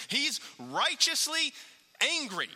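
impassioned sermon climb; building fervour. Real, not acted. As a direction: preach it with mounting heat. Preacher rising over Jesus' temple anger.

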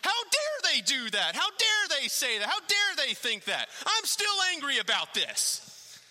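high, loud, indignant outburst; mock self-righteous fury, pitched high; indignant rant. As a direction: act out a petty grudge flaring up. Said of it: "and you're like".

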